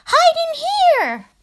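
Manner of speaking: very high, loud squeal; urgent little pig cry. A narrator's reading. Pig calling his brothers to hurry inside. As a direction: squeal out an alarm. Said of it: "cried the third little pig".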